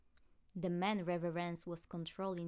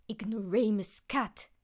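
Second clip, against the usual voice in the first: loud, hissed insult; angry yell; 3 semitones higher, 10 dB louder than usual. A narrator's reading. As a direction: spit the insult at the cat. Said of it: "she yelled".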